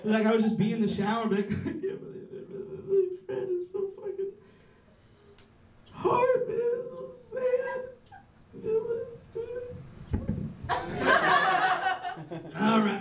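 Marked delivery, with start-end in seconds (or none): 1.5-7.8 high, whimpering fake cry; hammed-up blubbering, voice squeezed high; mock sobbing. Comic imitating himself crying in the shower, mock high sob. 8.6-12.6 mock sob laugh. Continues mock crying.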